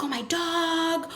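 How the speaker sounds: put-on high, drawn-out child's reply; excited kid answer. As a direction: answer like a delighted kid, slowly stretched. Voices kids answering the question.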